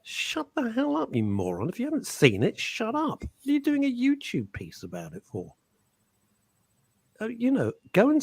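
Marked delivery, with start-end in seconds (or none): 0.0-5.5 retold rant. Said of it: "and I'm going".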